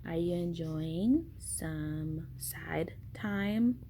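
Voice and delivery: hushed, breathy whisper. Real, not acted. Whispering to newborn.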